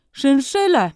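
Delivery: high, loud shout of the fur's name; shopkeeper's loud high call. A narrator's reading. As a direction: bellow the order to the back. Furrier shouts to his back room.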